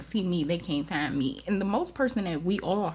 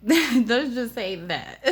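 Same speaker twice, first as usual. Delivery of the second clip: laughing; 6 semitones higher, 5 dB louder, much slower than usual. Real, not acted. Laughs at her own joke.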